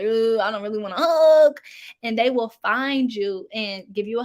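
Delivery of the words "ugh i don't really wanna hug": reluctant groan. Retold or imitated. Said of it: "I'll be, like, in a corner".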